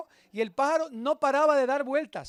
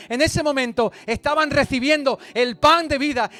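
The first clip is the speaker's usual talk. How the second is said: louder impassioned preaching; preaching at full force; 3 semitones higher, 9 dB louder than usual. Acted. Preacher lists everyone welcomed at the feast, loud and fervent. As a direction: build to a fervent climax.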